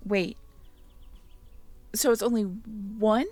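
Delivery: ordinary delivery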